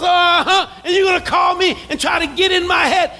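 loud, high retort; calling him out. Retold or imitated. Pastor catching out the caller trying to get in his head, loud.

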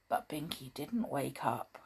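ordinary delivery, nothing marked

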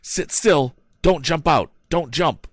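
high, loud urgent yell. A narrator's reading. Tom yelling to the woman in the wagon, urgent shout. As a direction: shout the order across the road. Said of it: "yelled the young inventor".

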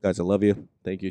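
ordinary talk, nothing marked

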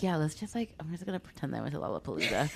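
hushed, fast scheming aside; quiet mutter. Retold or imitated. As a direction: rattle it off softly to yourself. Retold plan to fake festival.

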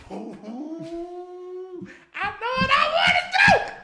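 very high squealing yell; gleeful shout. Retold or imitated. Answering the shoulder devil, high gleeful squeal. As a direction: squeal with naughty glee.

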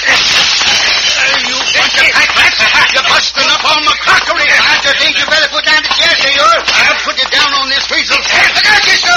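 high, loud excited yelling; brawling yell. Acted. Pancho yelling at bandits wrecking the crockery, loud and high. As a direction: holler through the fight. Sidekick yells during brawl.

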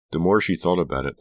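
plain reading voice

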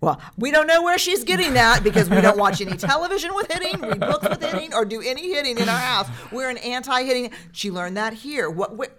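high, flustered, laughing mock defence; defensive parent. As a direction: ham up the flustered parent protesting innocence. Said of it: "your own shame stuff".

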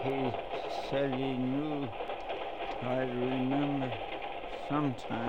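slow, soft and fading, barely holding on; dying words. Acted. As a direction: push each word out with your last breath. Dying man on the floor.

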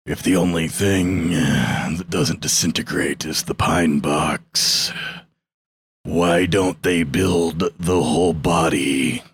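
deep, very slow, ominous wrestler voice; Undertaker voice. Acted. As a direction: drop low and crawl through each word like a dark ringside entrance. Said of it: "I gotta get it in my Undertaker voice".

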